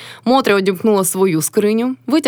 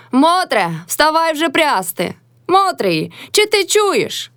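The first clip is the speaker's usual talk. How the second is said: high, drawn-out wake-up call; 8 semitones higher, much slower than usual. A narrator's reading. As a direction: rouse her with high, stretched calls. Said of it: "закричала з печі на Мотрю".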